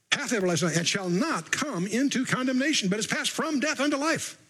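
scripture reading. Acted. Preacher reads John five to the congregation, scripture reading. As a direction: proclaim the promise from the text.

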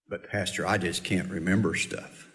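mock excuse. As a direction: mimic the lame excuse, low and small. Mocking a congregant's excuse.

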